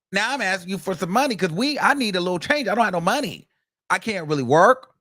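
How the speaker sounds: imitating her ranting at him; mock angry girlfriend. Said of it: "So she was pissed".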